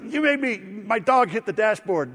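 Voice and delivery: high, loud imitated sputtering; mock angry rant. Driver blames pastor for dog's bump, mock angry rant. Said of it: "he just went like this".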